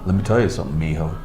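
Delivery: Sam Elliott impression. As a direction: lean back and talk low and easy like the old cowboy. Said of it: "I think he does Sam Elliott gr-, really well".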